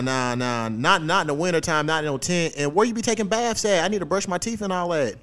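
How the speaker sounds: ordinary talk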